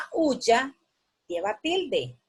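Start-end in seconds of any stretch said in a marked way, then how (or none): none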